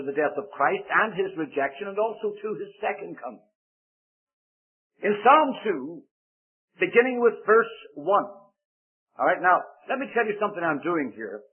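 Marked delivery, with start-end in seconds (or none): 5.0-8.3 slow, emphatic announcement of the reading; unhurried, with raised pitch; deliberately slow. Real, not acted. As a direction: spell it out slowly for the listeners. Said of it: "I am endeavoring to go slow".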